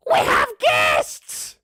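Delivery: very high, loud, slow grandma bellow; drawn-out high-pitched holler; booming voice. Acted. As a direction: boom out the announcement as an old woman. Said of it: "booming voice".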